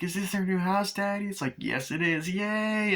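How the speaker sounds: put-on high-pitched child asking Daddy, then cheering; excited kid voice. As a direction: play the eager little kid at the new house. Voicing kid and dad arriving.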